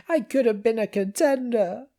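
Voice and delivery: high, tinny little-machine voice; squeaky machine voice. A narrator's reading. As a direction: pipe it up like a tiny gadget. Machine mimics famous line.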